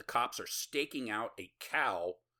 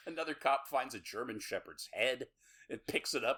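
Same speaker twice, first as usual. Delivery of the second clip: ordinary talk, nothing marked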